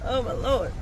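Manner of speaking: ordinary delivery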